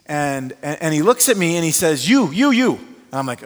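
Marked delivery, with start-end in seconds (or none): 2.1-2.7 higher, louder pointed repeats; excited insisting. Retold or imitated. Alex singling out the pastor, excited and loud. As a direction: jab the words at him excitedly.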